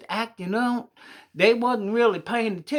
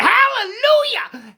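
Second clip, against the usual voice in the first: very high, loud and drawn out, slower; pained shriek; 7 dB louder, slower than usual. Acted. Middle boy jabbed by the needle, shrieking. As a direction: cry it out like a startled yelp of praise. Boy poked in rear end.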